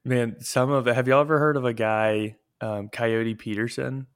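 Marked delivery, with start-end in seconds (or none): none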